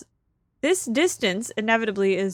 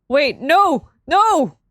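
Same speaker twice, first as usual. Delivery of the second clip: louder, higher no; loud dismay; 4 semitones higher, 7 dB louder, much slower than usual. Real, not acted. Streamer loses the hole, loud protesting no. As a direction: cry out in protest.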